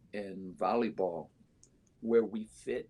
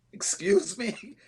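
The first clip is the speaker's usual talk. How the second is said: high, loud, drawn-out retold disbelief; incredulous; 14 semitones higher, 8 dB louder, slower than usual. Coach retelling her reaction to a ten-team offer, incredulous. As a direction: act out being stunned by an offer.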